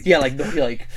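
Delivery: ordinary talk, nothing marked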